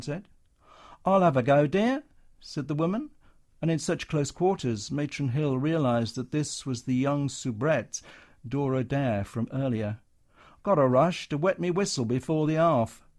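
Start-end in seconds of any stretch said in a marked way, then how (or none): 1.0-2.0 cheery showgirl. Acted. Floozy actress answers Matron. 10.6-13.1 Cockney showgirl. Acted. Floozy actress in a hurry.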